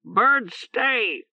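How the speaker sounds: high croaking bird squawk; hoarse bird voice. Acted. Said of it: "Oreb announced".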